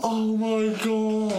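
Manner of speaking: high, slow, stunned gasp; overjoyed gasp. Acted. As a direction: act overwhelmed by the win. Mock winner reaction.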